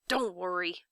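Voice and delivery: ordinary delivery, nothing marked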